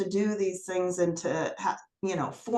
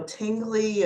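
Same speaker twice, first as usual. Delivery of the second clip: ordinary talk, nothing marked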